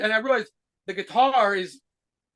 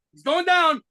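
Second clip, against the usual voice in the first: retold quote; 8 semitones higher, slower than usual. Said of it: "the last thing I heard was".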